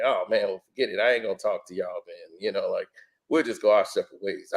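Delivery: very low, soft murmur; low, hushed imitation of giving up; imitated resignation. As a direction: back out quietly, deep and subdued. Voicing the reverend backing away.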